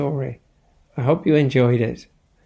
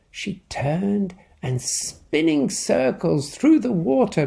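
lively picture-book reading; story narration; 4 semitones higher than usual. A narrator's reading. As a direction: tell it to a child at bedtime. Reads picture book aloud.

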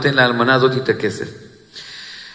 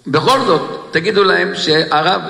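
normal talking voice

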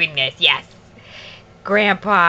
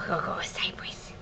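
hushed whisper; 3 semitones lower, 14 dB quieter than usual. Real, not acted. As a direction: whisper it like a secret. Whispered aside to baby.

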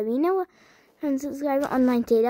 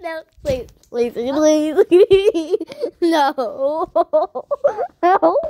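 louder and louder laughing pleas; 3 semitones higher, 5 dB louder, much slower than usual. Real, not acted. Friend grabbing the phone, pleading while laughing. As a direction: beg through laughter as they take the phone.

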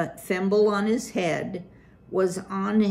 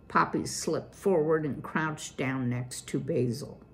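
ordinary delivery

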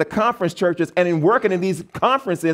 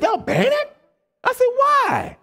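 much higher, incredulous questions; shocked disbelief; slower than usual. Retold or imitated. Preacher recalls questioning the conference president, high and shocked. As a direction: squeak it out in disbelief.

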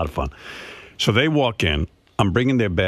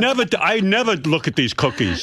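father scolding mother over the fortune, higher mock voice; imitating her father; 9 semitones higher than usual.

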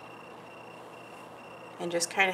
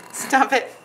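laughing; 2 semitones higher than usual. Real, not acted. Laughing at client.

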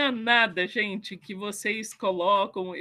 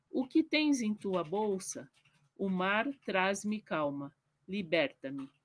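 poem reading; 3 semitones lower, 4 dB quieter than usual. Acted. Reads her own poem aloud.

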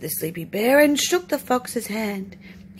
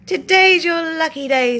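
eager sly fox; 6 semitones higher, 8 dB louder than usual. Acted. Fox tempting Bear.

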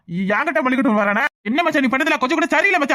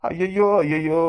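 mock lament; 8 semitones lower, much slower than usual. Drunk man laments his blunder.

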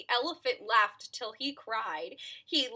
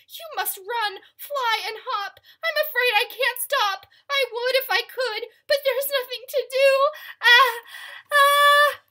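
high, mounting panic, building louder; urgent high voice swelling into loud ah-ahs; about to sneeze; 13 semitones higher, 10 dB louder than usual. Acted. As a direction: climb toward a huge sneeze.

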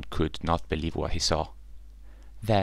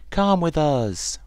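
higher, louder, slow call; 10 semitones higher, 6 dB louder, slower than usual. Acted. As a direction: call back across the sky. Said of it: "one called back".

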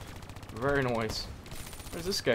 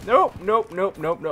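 voice crack; 6 semitones higher, 7 dB louder than usual. Real, not acted. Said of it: "Oh, that voice crack".

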